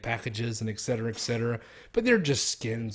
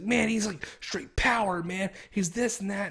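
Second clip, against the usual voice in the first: hammed-up eager clerk, higher; imitating eager clerk; 7 semitones higher, slower than usual. Clerk pushing Vegeta talk on a reluctant customer, lightly hammed-up and higher. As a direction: gush over Vegeta like a pushy salesman.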